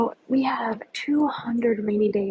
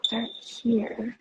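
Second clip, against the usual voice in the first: normal talking voice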